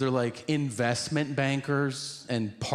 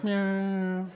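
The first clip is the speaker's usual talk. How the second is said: high, held whine; mock a strange drawn-out "myeah"; odd senator noise; 7 semitones higher than usual. Senator answering the comedian, weird high whine.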